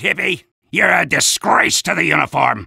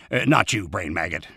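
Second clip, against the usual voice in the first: softer, lower apologetic aside; quiet aside; 7 dB quieter than usual. Acted. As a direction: drop the voice for a private aside. Aside to the brain maggot.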